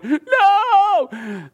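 sudden high shriek of dismay; panicked cry. Retold or imitated. Haman cries out when the eunuchs come for him, shrieking. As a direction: yelp it out like a cornered man.

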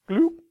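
high, loud cartoon swallowing sound; a silly gulp as it goes in the trash; mimicked trash-can gloop; comic gloop noise. Tossing advice in the trash.

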